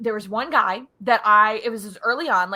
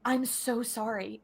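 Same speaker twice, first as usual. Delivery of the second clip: soft, slow mock apology; a touch of guilty quiet; imitating sorry employee; 8 dB quieter, slower than usual. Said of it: "Shannon, he felt so bad".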